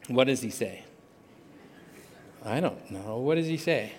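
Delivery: low, soft aside while searching; talking to oneself. Real, not acted. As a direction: think aloud quietly, low in pitch. Searching notes for a lost quote.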